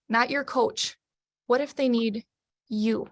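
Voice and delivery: normal talking voice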